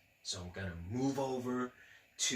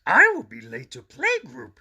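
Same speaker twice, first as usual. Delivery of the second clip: high, loud worried monster voice; Grover voice; 9 semitones higher, 15 dB louder than usual. Acted. Grover worrying about being late to playgroup. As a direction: fret like Grover running late. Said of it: "he said, jumping out of bed".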